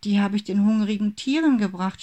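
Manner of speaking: put-on halting, stammering child; stuttering. A narrator's reading. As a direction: stumble over the first word, guilty. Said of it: "stotterte Pauli".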